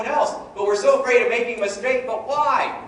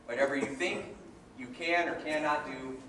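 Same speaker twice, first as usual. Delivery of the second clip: normal talking voice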